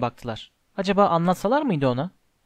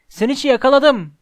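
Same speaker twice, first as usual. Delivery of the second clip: higher and louder, shouted in triumph; triumphant shout; 7 semitones higher, 5 dB louder than usual. A narrator's reading. Harry shouting to the crowd that he caught it, triumphant yell. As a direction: cry it out, holding the prize up high. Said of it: "diye bağırdı".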